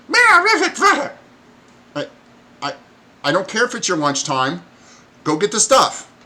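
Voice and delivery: very high, loud kobold whine; child kobold. Acted. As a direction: protest like a tiny kid.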